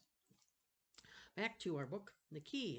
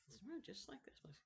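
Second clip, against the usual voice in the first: whispered aside; a quiet remark under the breath; 6 semitones higher, 8 dB quieter than usual. Real, not acted. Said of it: "unvoiced whisper".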